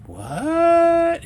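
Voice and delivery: long high startled what; shocked what. Retold or imitated. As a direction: squeal it in total disbelief. Shocked at cat splashing past.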